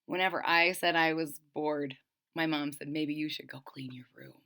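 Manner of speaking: plain reading voice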